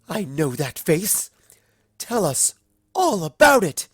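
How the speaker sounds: climbing high and loud to a squeal; eager squeal. Acted. Rarity begging Rainbow for date details, squealing. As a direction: demand the gossip, squealing.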